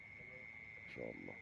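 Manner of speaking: a slow, hushed murmur of wonder; awed hush. Real, not acted. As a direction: breathe it out in quiet awe. Admiring the catch quietly. (همس بإعجاب)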